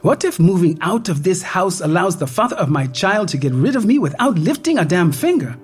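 high, rising panic, fast at the end; anxious pleading. Acted. Woman to virtual doctor about losing everything, high anxious pleading. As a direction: fire the fears at him one after another.

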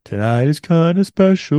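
sultry beer ad voice. Retold or imitated. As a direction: purr it like a beer commercial. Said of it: "sort of like, low brow".

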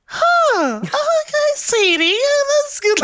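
mum's very high, strained-for-height squeak; highest-pitched voice. Retold or imitated. Mum answering her child's coming out, in a very high voice. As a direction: imitate a stunned mum in an extremely high voice. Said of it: "the highest pitched voice".

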